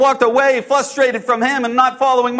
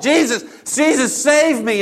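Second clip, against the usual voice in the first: high, urgent cry for help; retold yell; 5 semitones higher than usual. Disciple sinking under the waves, retold yell for help. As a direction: call out high for rescue. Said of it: "I just yelled".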